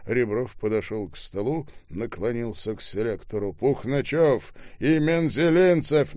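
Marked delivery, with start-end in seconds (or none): 3.5-6.2 loud, high, insistent call; shouted names. A narrator's reading. Ребров leaning to the intercom, shouting. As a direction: shout both surnames into the intercom. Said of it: "что вы кричите".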